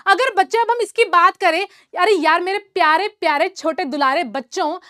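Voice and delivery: ordinary delivery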